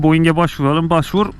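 ordinary talk

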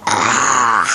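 deep, drawn-out zombie groan. Pretend. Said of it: "now my impression".

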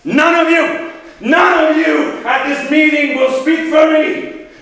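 high, loud, emphatic declaration; furious shout. Acted. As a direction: roar defiance at the whole assembly. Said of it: "but he's furious".

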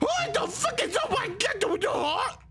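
high, shocked squeal of disbelief; startled outcry. Real, not acted. Streamer reacting to a heart in the game, startled outcry. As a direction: blurt it out way up high.

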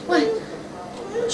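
ordinary talk, nothing marked

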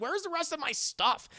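high, loud mock outrage; alarmed demand. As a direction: demand it in rising exasperation. Old man demands his missing things.

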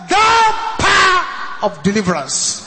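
high, loud prayer cry with a laugh; shouted prayer. Real, not acted. Pastor leading the church in declaring deliverance, shouting. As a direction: shout it out with joy. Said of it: "Now say".

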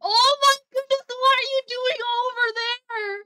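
loud, high, slowed disbelief; shocked shout. Real, not acted. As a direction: cry out in shock at a teammate. Shocked at teammate's spot.

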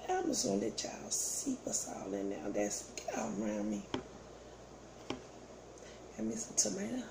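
ordinary delivery

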